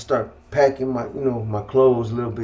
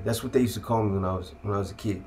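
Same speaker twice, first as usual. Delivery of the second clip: usual speaking voice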